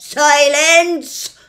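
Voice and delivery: very loud, high thunderous command; thundering. Acted. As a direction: silence the room. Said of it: "thundered the policeman".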